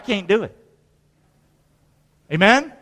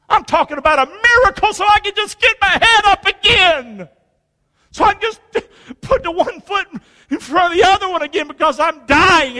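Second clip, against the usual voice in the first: pleading cry; 9 semitones higher than usual. Real, not acted. Preacher pleading with rising voice.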